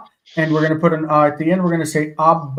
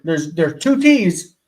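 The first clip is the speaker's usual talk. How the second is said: ordinary talk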